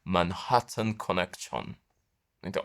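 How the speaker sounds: ordinary talk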